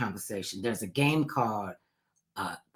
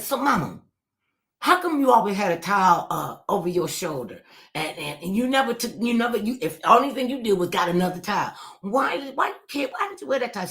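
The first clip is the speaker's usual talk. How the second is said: curious daughter; 7 semitones higher than usual. Retold or imitated. Daughter questioning mother.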